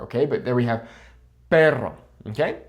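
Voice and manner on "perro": "perro" with a trilled R; rolled double R. Shown as a demonstration. Returning to the practice word, trilled "perro". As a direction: roll the R in "perro". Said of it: "than the double R".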